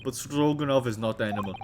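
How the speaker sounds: put-on loud, heavy Russian accent; Russian crewman. Crewman introducing himself to visitors, loud Russian accent. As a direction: boom it out like a proud Russian sailor. Stroganoff voice again.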